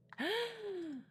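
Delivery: sudden high gasp for air; a short frightened gasp; sharp gasp. Acted. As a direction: suck in a quick scared breath. Panicked gasp in climb.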